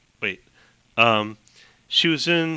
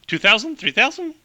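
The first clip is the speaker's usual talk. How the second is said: Amelia guessing the year; 8 semitones higher than usual. Acted. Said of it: "really fast clipped talk like Katharine Hepburn".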